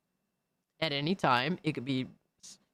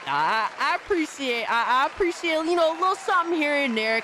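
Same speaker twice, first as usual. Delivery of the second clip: excited; 9 semitones higher, 4 dB louder than usual. Real, not acted. Overwhelmed by gifts.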